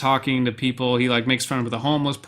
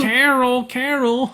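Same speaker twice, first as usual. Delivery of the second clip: high-pitched weaselly calling; Charlie Kelly; 10 semitones higher, 3 dB louder, slower than usual. Retold or imitated. Film fan echoing Charlie's call for Carol, weaselly impression. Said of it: "It's kind of high-pitched".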